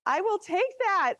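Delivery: excited. Real, not acted. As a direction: say it again, delighted. Repeats reply from space.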